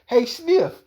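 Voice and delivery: high, loud taunting voice; a high, loud playground taunt; Rob Jeter's teasing. Retold or imitated. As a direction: bait him with a high jeering call. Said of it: "Rob Jeter called me Sniff".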